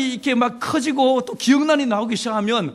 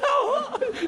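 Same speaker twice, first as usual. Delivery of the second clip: high squeal laugh. Real, not acted. Laugh after hen voice.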